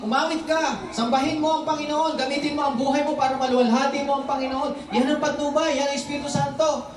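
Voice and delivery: lifted sermon voice urging worship; preaching. Acted. Pastor urging the congregation, preaching. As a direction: exhort the church to sing.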